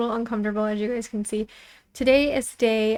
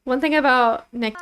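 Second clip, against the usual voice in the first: ordinary talk, nothing marked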